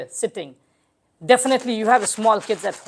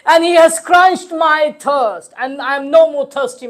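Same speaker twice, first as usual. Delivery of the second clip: impassioned lament; 4 semitones higher, 8 dB louder than usual. Acted. Preacher voices the dying son calling his father, impassioned lament. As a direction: cry it out in sustained tones. Recites dying son's farewell.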